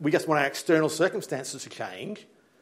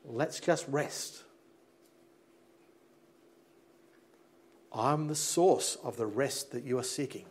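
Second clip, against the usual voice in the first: gentle voice of God; 2 semitones lower, 6 dB quieter than usual. Acted. God voiced inviting rest.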